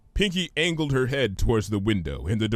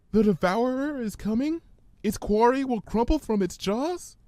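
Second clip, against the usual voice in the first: high, hesitant frightened pony; Fluttershy's voice; 12 semitones higher than usual. Acted. Fluttershy fearfully reciting the ominous words, timid and high. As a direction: stammer it out nervously, higher.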